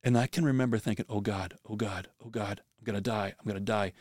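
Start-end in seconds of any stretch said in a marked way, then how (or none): 1.1-3.9 hushed, panicked pleading to God; a touch of remembered terror, spoken softly; desperate prayer. Retold or imitated. Drowning in the whirlpool, praying.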